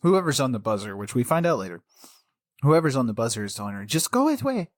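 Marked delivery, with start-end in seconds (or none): 3.8-4.7 high, curt dismissal; brisk starchy housekeeper. Retold or imitated. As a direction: shoo her off like a stern housekeeper. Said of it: "I sounded like, like an, a housekeeper".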